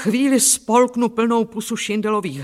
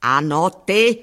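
moderately raised, mock roar; a narrator's restrained yell; roared reply; 4 dB louder, slower than usual. A narrator's reading. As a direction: call it out firmly, short of shouting. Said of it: "zařval".

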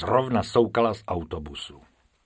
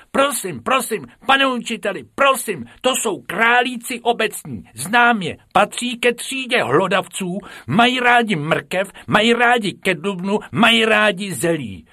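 much higher, louder know-it-all schoolgirl; eager top pupil; 11 semitones higher, 6 dB louder than usual. A narrator's reading. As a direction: rattle off facts to please the teacher.

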